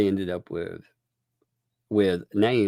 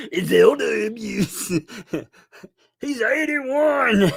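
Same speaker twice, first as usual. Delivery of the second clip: much higher, slow mock-shocked He's eighty-one; mock outrage; 13 semitones higher, slower than usual. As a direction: fake horror at his age.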